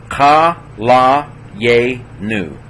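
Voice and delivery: ka la ye nu, very slow, a gap after each syllable; teacher's drawn-out breakdown of ka la ye nu. Shown as a demonstration. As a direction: sound out ka la ye nu one held syllable at a time. Teacher sounds word out by syllables.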